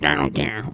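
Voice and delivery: garbled mock gobbling noises; imitated greedy eating. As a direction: gobble noisily like someone grabbing food. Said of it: "you're like".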